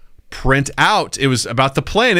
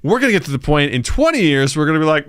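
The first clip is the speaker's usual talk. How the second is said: ordinary talk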